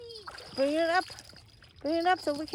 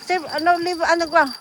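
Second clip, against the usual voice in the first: louder, higher urgent calls; shouting orders; 5 semitones higher, 9 dB louder than usual. Real, not acted. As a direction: bark directions across the bank. Directing during the catch.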